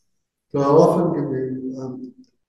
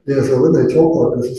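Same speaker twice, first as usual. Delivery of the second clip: ordinary delivery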